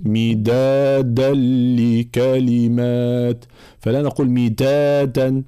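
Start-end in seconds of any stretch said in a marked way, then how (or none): 0.0-3.4 very slow chant, مدادا with hard voiced d's; firm voiced dal. Shown as a demonstration. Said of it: "صفة الشدة والجهر في الدال". 4.3-5.4 مدادا wrongly said with t-like d's, "mitatan"; slow recitation, devoice the د in مدادا towards ت; dal mixed with ta. Shown as a demonstration. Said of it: "تصبح مخلوطة بالتاء".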